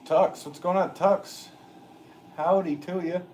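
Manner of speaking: high, loud southern twang greeting; southern accent. Acted. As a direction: holler a friendly southern howdy. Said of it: "throwing on a southern accent today".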